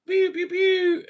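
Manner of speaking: high-pitched pew noises; imitated blaster fire. Retelling the speeder shootout, high mock blaster noises. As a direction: mimic laser shots, high and quick.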